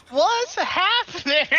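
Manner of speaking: high, loud, drawn-out cry of confusion; loud high-pitched bewildered wail; Harvey. Acted. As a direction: cry out slowly, high and loud, totally lost. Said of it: "Harvey goes".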